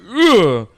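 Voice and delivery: loud, high mock grunt of effort; effortful swing grunt. Teacher swinging at student Sim, hammed-up loud grunt. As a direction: grunt as if throwing a punch. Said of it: "The, the teacher's like".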